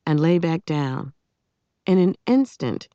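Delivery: plain reading voice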